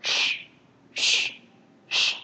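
drawn-out voiceless hiss; emphasised SH. Shown as a demonstration. Teacher telling students to stress it, hissed SH. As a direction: press hard on "sh", all air. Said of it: "các bạn nhấn mạnh lên".

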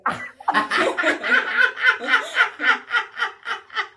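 high, drawn-out cackling laugh; long laugh. Real, not acted. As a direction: laugh long at your own jibe. Caller laughs at own jibe.

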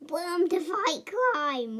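slow, drawn-out mock proclamation; slow announcement. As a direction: stretch each word out grandly.

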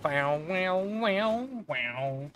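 drawn-out twanging "wow" noises; imitated twang. Streamer playing with a sound for laughs, imitated twang. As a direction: bend each note like a twang.

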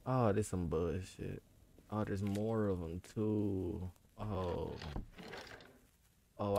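soft, low, uneasy; nervous murmur. Real, not acted. Gamer creeps through the horror house, softly and uneasily.

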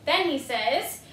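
ordinary delivery

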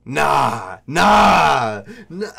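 大声で焦って否定; panicked loud denial. Retold or imitated. Told his mom is coming.

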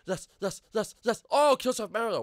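loud, high excited bursts; punchy elated shouting; triumphant cheering. Real, not acted. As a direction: celebrate the win loudly. Celebrates a kill in game.

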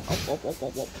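low, repeated boiling-water noises; imitated bubbling. Vlogger showing viewers how the water boils, bubbling imitation. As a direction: mimic bubbles popping in the pot.

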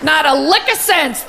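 ordinary talk, nothing marked

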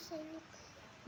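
very soft, slow and timid; shy, barely audible. Real, not acted. A shy pupil answers the teacher, barely audible. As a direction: mumble it shyly, too quiet to hear. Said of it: "जोरात सांग ना, मला ऐकायला नाही आलं".

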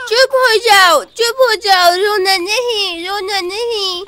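put-on slow, babyish coaxing; a small boy calming babies; toddler soothing. (छोटे बच्चे की तरह धीरे पुचकारना)

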